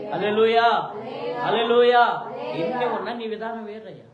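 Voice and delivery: loud, high praise shout; fervent praise call; rousing hallelujah. Acted. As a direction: lift the room with it. Preacher rouses the congregation.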